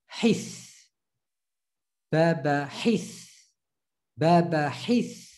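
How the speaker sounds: hith as one syllable, breathy h. Shown as a demonstration. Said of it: "as one syllable".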